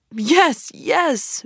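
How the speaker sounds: eager pleading, pitched high; begging. Acted. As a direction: plead like a child. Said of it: "begged Toad".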